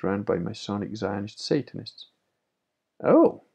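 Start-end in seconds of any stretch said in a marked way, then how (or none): 3.0-3.4 a sudden, very high, loud exclamation; surprised oh. Real, not acted. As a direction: burst out in surprise. Reacting to a viewer comment.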